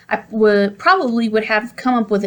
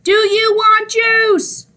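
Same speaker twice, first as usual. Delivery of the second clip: very high, slow scream; 12 semitones higher, 3 dB louder, much slower than usual. A narrator's reading. Mom screaming over the TV. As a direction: scream the question. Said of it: "Mom screamed".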